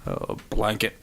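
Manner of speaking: half-swallow the word, dopey; mock dim-witted mumble; dopey mumbled blanket. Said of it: "it would be like".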